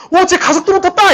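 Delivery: high-pitched amazed cry; cartoon onlooker exclaiming. Cartoon bystander watching someone race downhill, amazed exclamation. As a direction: call it out like a comic-book bystander. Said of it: "우리 만화 보면".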